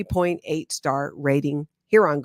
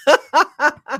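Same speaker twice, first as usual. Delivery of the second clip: loud laugh; 11 semitones higher, 7 dB louder than usual. Real, not acted. Laughing at own joke.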